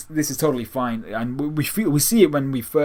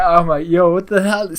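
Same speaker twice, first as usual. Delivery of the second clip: higher and louder, incredulous; amused shock; 7 semitones higher, 7 dB louder than usual. Retold or imitated. Fan reacting to Kami's slang, higher and louder. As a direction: let the surprise burst out. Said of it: "I was like".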